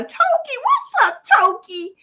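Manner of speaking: high, slow, loud cheer of a name; excited greeting. Real, not acted. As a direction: call out her name with delight. Greeting a viewer in live chat.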